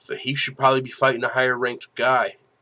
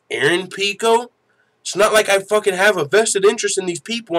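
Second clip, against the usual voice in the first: ordinary delivery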